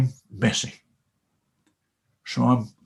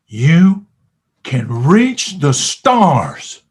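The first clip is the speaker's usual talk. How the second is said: louder, slow 'reach the stars'; stretched-out inspiring proclamation; rousing; 7 dB louder, much slower than usual. Real, not acted. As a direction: lift the crowd. Motivational climax.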